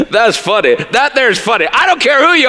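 laughs it out, up high; laughing. Real, not acted. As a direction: cracks up at his own joke. Laughing at the name Anus.

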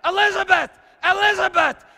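high, loud desperate shouting; desperate screaming. Retold or imitated. Father screaming to his terrified daughter, high and loud. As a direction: scream her name to break through. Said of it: "I just started screaming".

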